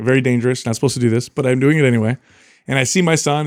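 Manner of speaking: ordinary talk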